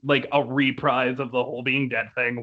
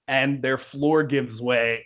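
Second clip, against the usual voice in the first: ordinary delivery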